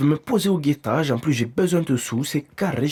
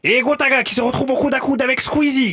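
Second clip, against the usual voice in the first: race commentary; 10 semitones higher than usual. Acted. Commentaire de course façon reportage.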